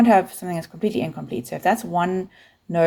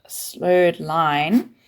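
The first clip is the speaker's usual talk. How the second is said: ordinary delivery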